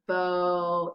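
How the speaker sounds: "bo" held long, stretching the O sound; drawn-out long O. Shown as a demonstration. Teacher showing students the long O in "bo", very slowly. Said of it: "It has a long O sound".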